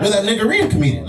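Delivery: high, eager voice of a stranger guessing; lightly voiced eager stranger; curious stranger. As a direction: play the nosy guy who thinks he knows you. Stranger quizzing him.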